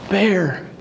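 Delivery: put-on high, excited little boy gasping at the stars; a grandson amazed, nearly out of breath; awed small child; breathless child's awe. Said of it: "like, almost out of breath".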